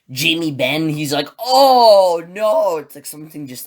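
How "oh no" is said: loud, high, drawn-out wail; hammed-up cry of dismay; mock anguish. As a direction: imitate a player stung by the loss. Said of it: "he just got stung pretty hard".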